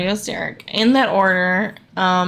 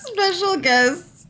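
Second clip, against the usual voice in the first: laughing; 13 semitones higher than usual. Real, not acted. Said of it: "I can't do it".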